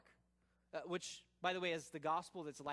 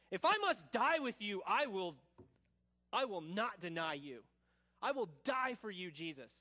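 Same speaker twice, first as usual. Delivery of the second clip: loud, fervent vow; emphatic; 5 dB louder than usual. Acted. Peter swearing he will die for Jesus, emphatic. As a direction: declare it forcefully, punching key words. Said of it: "Peter, as emphatically".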